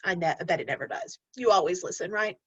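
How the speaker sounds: normal talking voice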